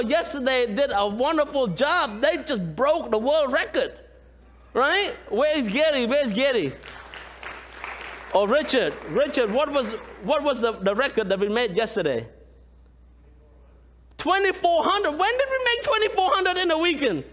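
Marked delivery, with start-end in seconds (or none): none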